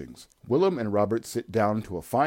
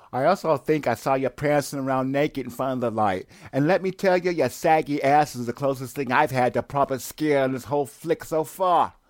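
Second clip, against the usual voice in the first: higher, louder taunting; loud mocking rant; 7 semitones higher, 6 dB louder than usual. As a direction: jeer loudly at the old man. Mocking Willem.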